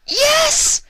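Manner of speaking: shrieked yes, very high and loud; high-pitched screech. Acted. Vinyl screeches her yes to the tour offer. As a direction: squeal it out in pure delight. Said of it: "Vinyl screeched in a high-pitched voice".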